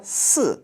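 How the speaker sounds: "si" starting high, falling straight down; fourth tone. Shown as a demonstration. Teacher showing learners number four, falling fourth tone. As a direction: drop sharply from high on "si". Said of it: "Again, fourth tone".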